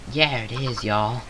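drawled Southern American twang; lightly put-on Southern American accent. Shown as a demonstration. Southern American accent tried out on Minecraft viewers. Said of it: "I sound really, uh, southern".

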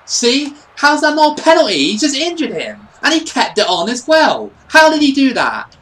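high, loud outraged complaint; indignant protest. Real, not acted. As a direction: protest the referee's call in disbelief. Protesting a missed penalty.

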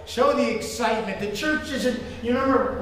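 normal talking voice

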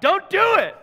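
high, loud desperate yell; shouting down a man about to jump. Retold or imitated. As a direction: shout to stop him jumping. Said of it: "I yelled out".

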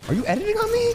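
squeaky mock outrage; high mock squeal. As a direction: squeal the repeat in mock shock. Repeated taunt, squeal.

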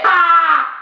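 sudden loud yelp; a quick mock squeal; jokey high yelp; playful shriek. Real, not acted. Playful outburst at herself.

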